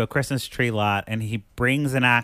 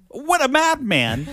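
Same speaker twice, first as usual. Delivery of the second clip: high, slow astonished cry; amazed; 5 dB louder, slower than usual. Retold or imitated. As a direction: marvel at the madness, drawn out. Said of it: "which I was like".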